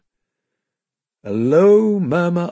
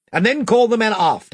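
higher, louder king's call; crying out; 10 semitones higher, 5 dB louder than usual. A narrator's reading. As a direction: call the order across the deck. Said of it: "cried Caspian".

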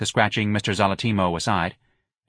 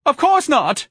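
very high, loud scream; piercing, hysterical outburst; 6 dB louder, slower than usual. A narrator's reading. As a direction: shriek the denial at your husband. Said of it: "Missus Levy screamed at her husband".

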